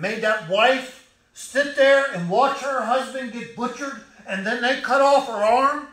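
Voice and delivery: high and loud, driving the sermon on; impassioned preaching. Acted. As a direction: thunder it from the pulpit. Preacher recounting atrocities passionately.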